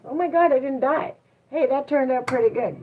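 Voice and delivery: relieved, pleased inner voice; cheering yourself on after a speech; mock celebrating. Speaker celebrates herself after public speaking, relieved and pleased. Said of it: "you're gonna celebrate yourself".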